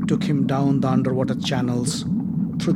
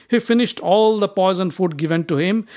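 usual speaking voice

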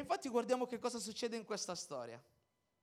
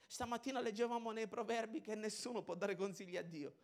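usual speaking voice

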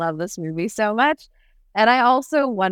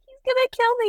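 panicked squeak; much faster than usual. Retold or imitated. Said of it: "She's like".

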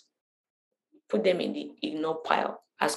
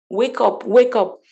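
higher, louder, forceful urging; assertive wake-up call; 4 semitones higher, 7 dB louder, slower than usual. Acted. Imitating Jesus rousing his listeners, forceful raised tone. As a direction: punch it out with force. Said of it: "that tone of".

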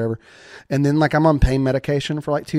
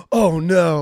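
aunt's alarm; 5 semitones higher, 6 dB louder than usual. Retold or imitated. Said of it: "like that".

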